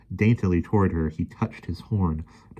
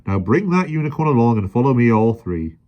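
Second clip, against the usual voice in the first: loud, high barked orders; bossy policeman; 5 semitones higher, 8 dB louder than usual. A narrator's reading. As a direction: order them along officiously. Policeman ordering them along.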